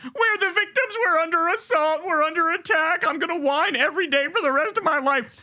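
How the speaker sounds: high, nasal-free whining parody of a self-pitying victim; mock whiny victim. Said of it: "They whine, they cry".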